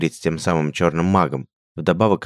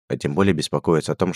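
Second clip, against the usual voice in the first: usual speaking voice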